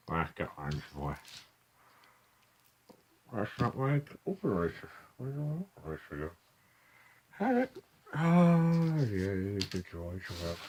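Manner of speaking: muttering around a pen; pen in teeth. Real, not acted. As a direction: talk with a pen in mouth. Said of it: "what I'm muttering around a pen in my mouth".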